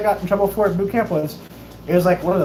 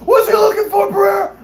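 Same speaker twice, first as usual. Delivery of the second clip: high, loud shout of disbelief; hammed-up incredulous holler; mock shouting; 13 semitones higher, 6 dB louder, slower than usual. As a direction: imitate a chief yelling a demand. Acts out chief demanding answer.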